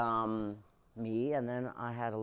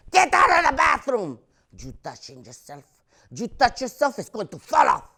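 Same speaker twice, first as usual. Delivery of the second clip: much higher, loud mock yell; mock mom yelling; 12 semitones higher, 14 dB louder than usual. Mom yelling into the bathroom, high loud mock yell. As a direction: shriek it through the door.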